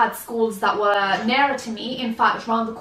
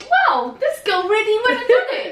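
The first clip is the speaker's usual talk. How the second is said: laughing amazement; 12 semitones higher, 5 dB louder than usual. Retold or imitated. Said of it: "I thought".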